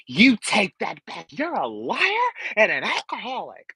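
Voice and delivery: fierce reality star accusation; imitating a Housewife. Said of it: "like she delivered that line to Kim".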